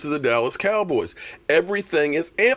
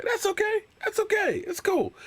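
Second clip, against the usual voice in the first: high, soft, mock soothing falsetto; 6 dB quieter than usual. Mocking Eagles fans who like the abuse, in a high soft falsetto. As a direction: coo it in a high thin voice like soothing a baby.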